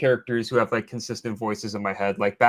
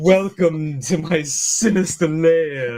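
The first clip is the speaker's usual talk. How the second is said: hammy villain voice, higher and louder, slow; over-the-top sinister voice; 5 semitones higher, 4 dB louder than usual. Retold or imitated. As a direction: purr like a theatrical cartoon villain. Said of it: "my over-the-top sinister voice that I do".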